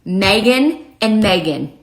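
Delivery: both Megan words slow and sounded alike; two identical Megans. Shown as a demonstration. Showing what friends said, Megan twice identically. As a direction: say Megan and Megan with the same vowel, spaced out. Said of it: "my friends were saying".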